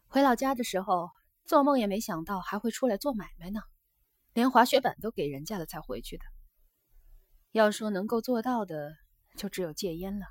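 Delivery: lightly voiced geisha, a little livelier; a hint of an earnest young geisha; geisha confiding. Geisha tells her guest of changes at home, confiding.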